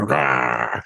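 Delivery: low mock growl of a bear; growly bear roar. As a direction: play Putin as a growling bear. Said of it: "play a big growly bear going".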